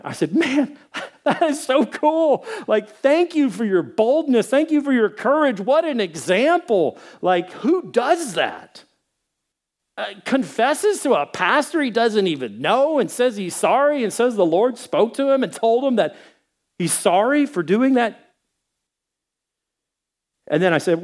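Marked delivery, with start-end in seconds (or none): 0.3-8.8 high, laughing, overjoyed; amazed delight. Retold or imitated. Overjoyed reply to developer. 9.9-18.3 amazed. Real, not acted. Marvels at the developer.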